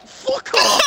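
high, loud yell; angry outburst. Real, not acted. Gamer pleading for a penalty erupts, angry outburst. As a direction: shout it with pitch leaping up.

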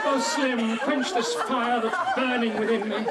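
loud, swooning stage heroine; melodramatic heroine. Acted. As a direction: swoon it out, hammed up. Acting the love scene.

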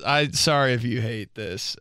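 normal talking voice

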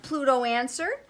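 ordinary delivery

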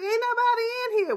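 put-on high, fast sassy girl's retort; sassy young voice. Girls sassing back at Aunt Vi, high and quick. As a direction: toss it back quick like a teen. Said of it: "She was like".

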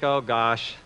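low, soft voice to himself; acted quiet second thoughts; regretful thinking. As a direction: think aloud remorsefully, hushed and low. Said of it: "sits down and starts to think".

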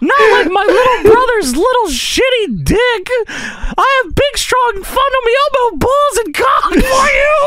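very high boastful puppet voice; Elmo. Acted. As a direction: brag in a squealing Elmo voice. Elmo boasting about himself.

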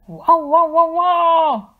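very loud barking; booming dog bark. A narrator's reading. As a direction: bark loud to scare off the wolf. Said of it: "浑厚的叫声".

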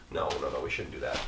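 deep, soft mock-stern refusal; stern inner voice. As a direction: play the sensible conscience saying no. Answers own whispered temptation.

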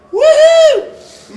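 high, loud "woohoo"; excited whoop. Real, not acted. Host opening the award chase, excited whoop. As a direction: celebrate with a big high whoop.

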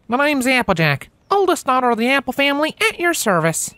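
high, lilting country mare, firm; a country mare warning a city pony; Applejack's country voice. Acted. Applejack introducing herself.

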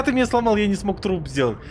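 ordinary delivery

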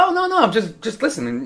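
mock innocent girl. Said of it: "She'd be like".

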